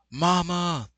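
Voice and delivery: high, loud cry of Mama; mock cry for mom. Imagined son finding his mom, crying out high and loud. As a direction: yelp it out high like a scared kid.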